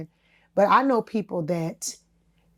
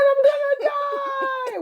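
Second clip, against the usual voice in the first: high, slow, hammed-up wail; mock the nurse's doom, crying it out; much slower than usual. Imitating the nurse's grim prediction, mock wail. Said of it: "She said".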